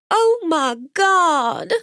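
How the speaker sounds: high, slow horrified cry; shocked gasp. A narrator's reading. Jolene gasps seeing the scene.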